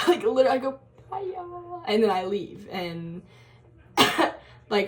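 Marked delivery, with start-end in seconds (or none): 1.1-1.8 high, very soft farewell; mumbled sheepish exit line imitating herself; awkward goodbye. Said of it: "I'm really awkward at saying goodbye".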